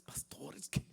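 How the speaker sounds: imitating a frightened man whispering through tears; tearful whisper. Scared church member coming to pastor Max, whispered and tearful. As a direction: breathe "Pastor, es que" as a shaken whisper.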